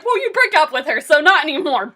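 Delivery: loud, mock-scolding comeback; sassy retort. Fan telling Sam off over his ex, mock scolding. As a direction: fire it back loudly, putting someone in place.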